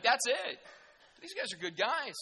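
usual speaking voice

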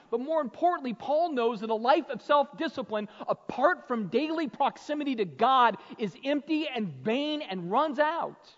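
loud, high, driving sermon voice; fired-up pulpit delivery; full-force preaching. Acted. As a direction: preach it out to the back row.

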